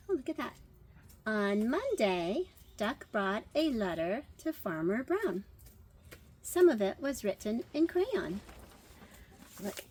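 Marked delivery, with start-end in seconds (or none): 1.3-8.4 put-on lively read-aloud for children; story narration. Teacher reading to children, lively story narration. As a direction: tell the tale to the class, brightly. Reads picture book aloud.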